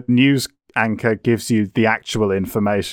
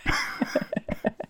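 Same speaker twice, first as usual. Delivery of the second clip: laughing; 9 dB quieter than usual. Real, not acted. Laughing at derailed tangent.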